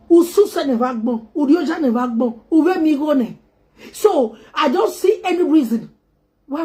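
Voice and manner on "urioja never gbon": ordinary talk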